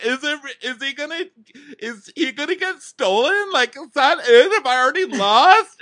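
very high, panicky imitation of a crybaby; whiny high-pitched tearful questioning; mock sobbing. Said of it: "she's sobbing".

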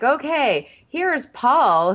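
high, announcing host voice; mock presenter. Hosts introducing Paul on the show, mock presenter.

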